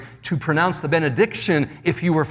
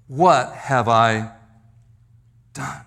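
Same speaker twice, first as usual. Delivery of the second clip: slow, low, halting remorse; deep regret; 3 semitones lower, slower than usual. Retold or imitated. Peter after denying Jesus, slow and regretful. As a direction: let it sink, with a long pause before the last word. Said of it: "His response was major regret".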